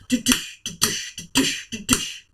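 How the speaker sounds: unvoiced da-douche thuds; a small creature bouncing heard from upstairs; whispered bouncing sound. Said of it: "It sounds a lot like a".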